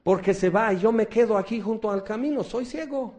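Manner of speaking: high, urgent voice of a blind beggar; blind Bartimeo. Retold or imitated. Blind beggar by the road calling out to Jesus, high and urgent. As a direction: blurt it out before the chance passes. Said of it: "Bartimeo dice".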